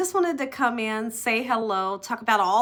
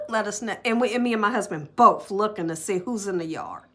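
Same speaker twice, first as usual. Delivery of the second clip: ordinary delivery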